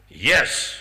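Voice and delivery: imitating a boyishly amused guru; smiling. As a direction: say it through a big smile. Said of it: "this big smile came on his face".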